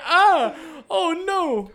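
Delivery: very high put-on voice; hammed-up high-pitched alarm; mock-startled victim. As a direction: squeal it in mock dismay. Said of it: "He's like".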